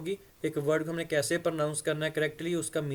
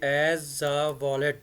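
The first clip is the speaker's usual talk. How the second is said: slow model reading; much slower than usual. Shown as a demonstration. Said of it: "यानी एक तिजोरी के तौर पर".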